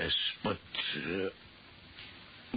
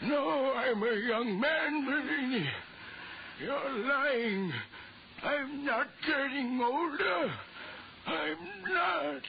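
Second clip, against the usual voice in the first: high, strained cries of refusal; terrified young man begging it isn't true; panicked denial; 13 semitones higher, slower than usual. Acted. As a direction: shriek it like you can't accept it. Young man denies aging, panicking.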